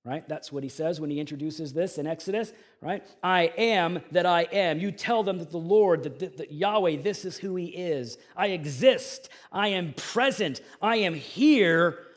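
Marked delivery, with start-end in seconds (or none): 3.2-12.0 preaching at full force. Acted. Pastor declaring God's plan to the congregation, loud preaching. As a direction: proclaim it to the whole room.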